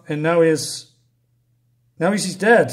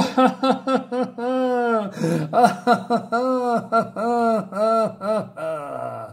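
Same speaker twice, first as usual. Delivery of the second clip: mock evil laugh; 5 semitones higher than usual. Mock villain laugh after quote.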